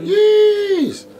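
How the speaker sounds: very high, loud cheering praise; imitated hype-up. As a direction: whoop it up to boost a fellow MC. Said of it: "and boost you".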